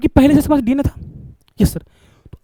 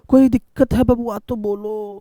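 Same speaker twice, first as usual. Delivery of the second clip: coaxing; 3 semitones lower, 5 dB quieter, much slower than usual. Acted. Teacher coaxing students, calls them बबुआ.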